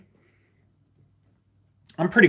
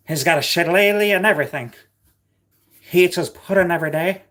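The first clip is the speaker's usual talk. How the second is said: higher, louder jokey Irish lilt; mock Irish accent; 8 semitones higher, 6 dB louder, faster than usual. Streamer teasing his friend Jamie in a mock Irish accent. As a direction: ham up a stage Irishman for a laugh.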